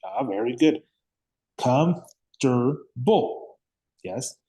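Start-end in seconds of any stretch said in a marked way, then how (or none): 1.6-3.6 "Comfortable" slowly in three separate beats, kumf, ter, bul; broken into syllables. Shown as a demonstration. Teacher counting syllables for the student, "Comfortable" split slowly in three. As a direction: sound out "Comfortable" with gaps between syllables. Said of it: "How many syllables does this have?".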